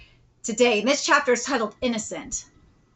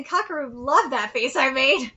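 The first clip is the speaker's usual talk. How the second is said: ordinary delivery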